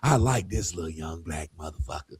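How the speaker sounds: low, soft confession from within; hushed, low inner voice; dark side. Retold or imitated. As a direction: drop low and quiet, voicing your secret side. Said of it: "my dark side".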